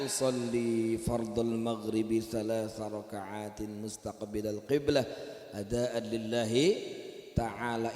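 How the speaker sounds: reciting the prayer intention aloud; recited intention. Shown as a demonstration. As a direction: recite the niat solemnly. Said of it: "ada namanya talafuz bin niat".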